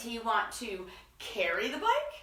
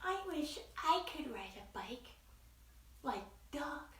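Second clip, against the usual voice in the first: mouse's thoughts; 5 semitones higher, 10 dB quieter than usual. Acted. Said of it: "what he thought was".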